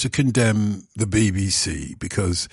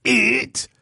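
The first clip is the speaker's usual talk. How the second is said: IT hit much higher, like a misread bulletin; stressed it. Shown as a demonstration. Newsreader demonstrating a wrongly stressed It. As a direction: lean hard on It as a wrongly stressed word. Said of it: "if I was to read a news bulletin and I said".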